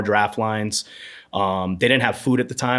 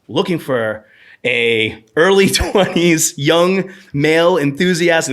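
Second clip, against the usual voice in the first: higher, louder, slowed ad read with a laugh; mock ad reading; 6 semitones higher, 5 dB louder, slower than usual. Reading the Facebook job ad aloud, hammed-up and laughing. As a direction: read the post like a cheesy ad.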